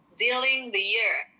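carefully paced example; slow example. Shown as a demonstration. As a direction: read slowly. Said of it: "slow example sentence". (dibaca pelan sebagai contoh)

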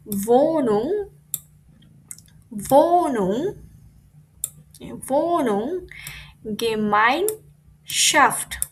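"Wohnung" stretched and rising, "Gemeinschaft" in slow separate pieces; breaking the word up. Shown as a demonstration. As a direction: sound out "Gemeinschaft" syllable by syllable, very slowly. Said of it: "কিভাবে ভেঙে ভেঙে পড়বো".

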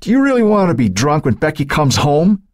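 scolding. A narrator's reading. Husband scolds his drinking wife.

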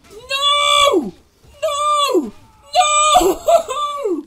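high, loud panicked screams; screaming no. Real, not acted. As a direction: scream it out in horror. Dying in game, screaming.

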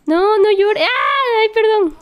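high, loud flustered pleading. Real, not acted. Player hushing crying babies and apologising, flustered. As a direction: plead in a high voice, then exclaim.

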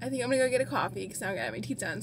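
usual speaking voice

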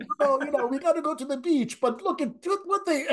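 a hammed-up Brazilian Portuguese accent, pitched high; mock Brazilian accent. [name] imitates his Brazilian tech guy in a mock Brazilian Portuguese accent. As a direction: play a carefree Rio local, badly faked Portuguese accent. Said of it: "I can't do a Portuguese accent".

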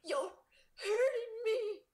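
high, slow, crying out in pain; pained gasp. A narrator's reading. Riley crying out in pain as the hooks dig in, gasping and pleading. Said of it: "Riley gasped".

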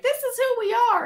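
high, lightly mocking school voice; imitating teachers. School teachers telling kids about the Stampede, high mock voice. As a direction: chirp it like a proud teacher. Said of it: "they're like".

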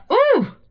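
squealed high ooh; a flustered high squeal. Real, not acted. After the old lady's comment, a flustered high ooh. Reaction to being seen.

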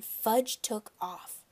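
ordinary delivery, nothing marked

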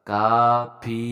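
কাফি in two drawn-out syllables, long "aa" then long "ee"; long vowel demo. Shown as a demonstration. Teacher explaining alif and ye maruf, slow কাফি demo. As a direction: stretch both vowels of কাফি very slowly. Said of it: "ফে না বলে পি বলতেছে".

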